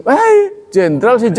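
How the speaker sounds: sharp high jeering call, then a teasing jab; mocking jeer. Teacher imitating people jeering at a crying general, high mock. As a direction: tease a crying general like a heckler.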